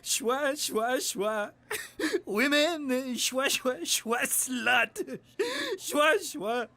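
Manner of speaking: put-on drunken sobbing. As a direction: slur and sob into your drink. Drunk character blubbering.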